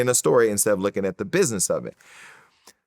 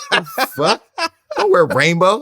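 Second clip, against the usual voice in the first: high, loud, through laughter; laughing retort; 10 semitones higher, 6 dB louder, slower than usual. Retold or imitated. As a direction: protest while cracking up. Laughing retort to the rainbow shirt.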